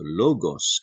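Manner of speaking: LO-gos, pitch peak up front. Shown as a demonstration. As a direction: raise the voice on the first syllable of "logos", then drop. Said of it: "accent doon itinataas yung boses".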